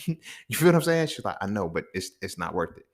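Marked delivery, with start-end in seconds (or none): 1.4-2.9 much softer, hushed calming of a friend; quiet pleading. Retold or imitated. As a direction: talk a friend down, barely above a hush. Homegirl calming him in class.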